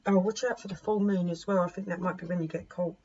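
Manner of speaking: ordinary talk, nothing marked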